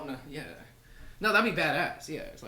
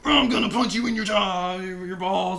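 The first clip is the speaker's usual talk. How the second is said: shouted, slow mock threat; mock threatening shout; 4 semitones higher, 6 dB louder, slower than usual. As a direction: yell it like a thug forcing drugs on you. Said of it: "Richard held me down and said".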